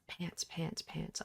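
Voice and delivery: soft breathy panting words; whispered pants. Acted. Reading panting in game text.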